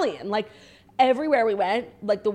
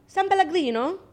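"San Pellegrino" with rolled Italian vowels, higher; Italian accent; 9 semitones higher, 3 dB louder than usual. Shown as a demonstration. Demonstrating her Italian accent to a friend, Italian accent. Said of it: "I got really good at the accent".

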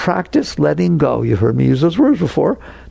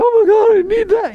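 needy whine. Retold or imitated. Mocking someone who clings to a nice moment, high needy whine. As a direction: beg like you can't live without it. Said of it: "Don't sit there and say".

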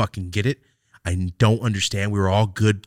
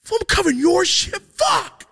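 high, loud mocking yell of the roommate; angry shout; 7 dB louder than usual. Retelling what the roommate yelled at him, as a high loud shout. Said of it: "even though he's like".

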